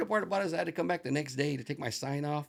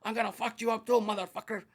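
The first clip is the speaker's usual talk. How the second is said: higher, louder imitation of the furious owner; hammed-up angry husband, broken English; mock angry threat; 7 semitones higher, 4 dB louder than usual. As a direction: threaten him like a jealous husband. Homeowner threatening him.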